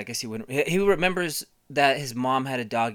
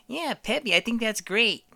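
higher goofy dad voice; 10 semitones higher than usual. Retold or imitated. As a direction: cheer like a dopey father. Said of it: "big dumb Bill Hader laugh".

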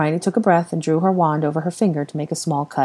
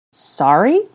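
confused; 5 semitones higher, 5 dB louder than usual. Acted. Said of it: "Hermione asked in confusion".

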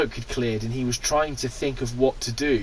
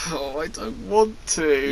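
reluctant whine; 4 dB quieter than usual. Real, not acted. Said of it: "I don't wanna do this bit".

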